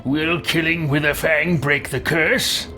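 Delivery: usual speaking voice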